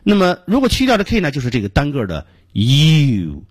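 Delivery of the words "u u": "U" as a long drawn-out yoo; teacher's drawn-out model "U"; forceful letter U. Shown as a demonstration. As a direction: say "U" long, firm and precise. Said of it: "要发的有力量和准确".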